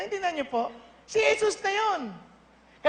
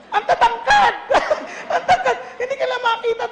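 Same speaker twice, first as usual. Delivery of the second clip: laughing; 8 semitones higher, 5 dB louder than usual. Real, not acted. Joking about tall Americans, laughing.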